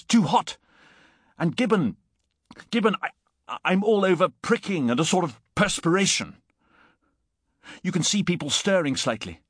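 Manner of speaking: high-pitched, urgent alarm; frantic warning. A narrator's reading. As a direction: plead breathlessly with a heedless friend. Frantic shouted warning continues.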